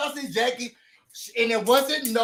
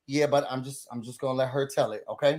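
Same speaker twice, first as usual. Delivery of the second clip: lower, quick, teasing hold-back; coy low refusal; 8 semitones lower, 5 dB quieter, faster than usual. Retold or imitated. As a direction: keep the gossip to yourself. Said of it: "Jackie's like".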